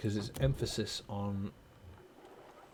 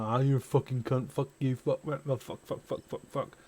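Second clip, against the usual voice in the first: mock vulgar rant; 2 semitones higher than usual. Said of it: "not just going".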